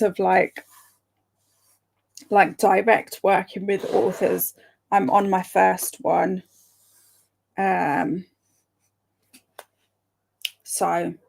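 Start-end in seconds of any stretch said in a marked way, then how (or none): none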